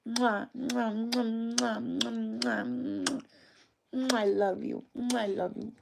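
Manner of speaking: shower the dog with kisses; smacking kisses. Real, not acted. Kissing the dog.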